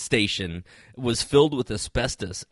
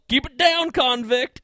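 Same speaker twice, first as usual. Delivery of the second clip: high, raised comic holler; mock cop yell, pitched way up; re-enacted yell; 13 semitones higher than usual. Retelling the shout before spotting a prison convoy, mock yell. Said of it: "but I literally yell".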